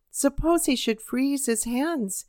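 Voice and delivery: ordinary delivery, nothing marked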